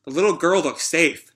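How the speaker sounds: ordinary delivery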